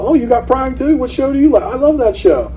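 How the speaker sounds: high, loud, eager chit-chat; gushing enthusiasm. Retold or imitated. Agent bonds with the seller over a shared show, gushing. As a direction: gush like a new best friend.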